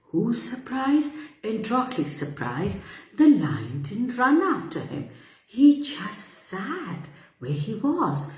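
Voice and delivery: plain reading voice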